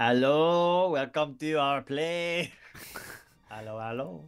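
a welcoming French innkeeper greeting his guests; French accent. Acted. Professor greets the party over breakfast in a French accent. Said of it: "switching back over to French".

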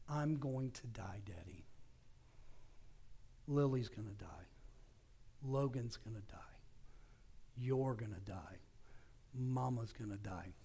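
quietly voicing a scared four-year-old; a hint of a little boy's hushed voice; child's voice. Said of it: "That's the way he actually said it".

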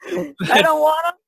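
high, laughing through the words. Real, not acted. Guest joking about taking the statues, laughing hard. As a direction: crack up while insisting you want them.

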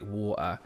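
"water" with the t swallowed; glottal stop. Shown as a demonstration. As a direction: catch "water" in the throat instead of saying t. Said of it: "the glottal stop".

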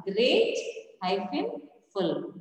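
"great" and "full" set apart, "hyphen" between; split into syllables. Shown as a demonstration. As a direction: break after "great", then a separate "full". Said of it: "when you split it".

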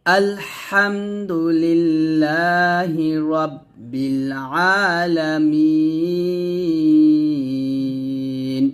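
very slow chanted recitation, last word drawn out long; tajweed recitation of the verse; slow Quran recitation. Shown as a demonstration. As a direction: recite the verse melodically, stretching আলামিন. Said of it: "আবার".

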